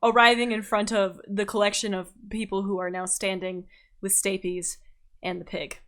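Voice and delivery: ordinary talk, nothing marked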